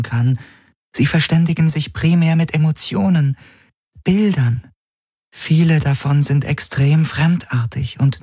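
beautiful, high, even voice; an artificial mind reporting in high, level tones; Helix. Acted. The Helix whispers its answer in his head, beautiful even voice.

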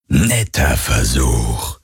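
very low, drawn-out phantom; slow, deep voice of a ghostly tormentor. A narrator's reading. Phantom to its victim Galen, deep and drawn out. Phantom mocks the failed attack.

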